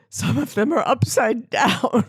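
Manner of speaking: slow, baffled mock-receptionist voice; quoting the receptionist. Receptionist puzzled by upside-down cards, slow and baffled. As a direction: imitate a bewildered clerk, slowly. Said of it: "and I quote".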